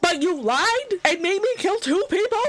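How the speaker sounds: you were lied to and made to kill; outraged disbelief. Real, not acted. Learns she lied all along.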